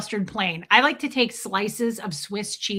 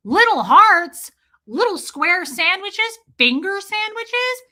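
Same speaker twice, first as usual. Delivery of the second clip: high, slow, gushing; mock enthusiasm; 10 semitones higher, 7 dB louder, slower than usual. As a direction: coo over each sandwich shape. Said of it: "I'm gonna be like".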